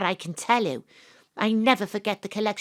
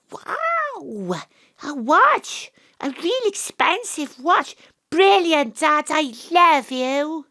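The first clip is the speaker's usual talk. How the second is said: high, excited squeals; delighted child; thrilled child; 5 semitones higher, 5 dB louder, slower than usual. Acted. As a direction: gush like an eight-year-old. Child thrilled by watch gift.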